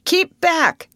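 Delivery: high, loud warning; warning yell. A narrator's reading. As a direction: yell at them to stop. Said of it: "yelled the man from the steamer".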